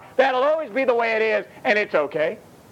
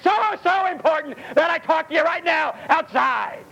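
higher, shouted mockery of the pushy; 8 semitones higher than usual. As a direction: blurt it out like an impatient outsider. Mocking pushy outsiders, shout.

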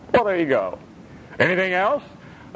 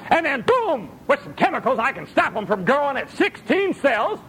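high, eager salesman scientist; hammed-up doctor pitch; mock scientist; 8 semitones higher than usual. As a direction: sell the miracle cure.

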